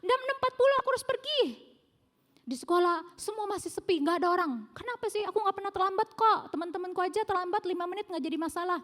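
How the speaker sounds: put-on high, loud, fast complaining kid voice; a teen protesting to mum, high-pitched and loud; whiny child. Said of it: "Dia bilang kayak gini".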